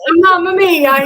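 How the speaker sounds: loud, high-pitched, hammed-up Italian; mock Italian accent. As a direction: tease with a big theatrical Italian accent. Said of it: "he speaks with a Portuguese accent, but it's Italiano".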